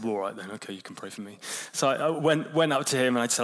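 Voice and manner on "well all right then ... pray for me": lower, softer grudging mumble of the grumpy colleague. Retold or imitated. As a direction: play the tough bloke giving in, low and quiet. Grumpy colleague reluctantly agrees.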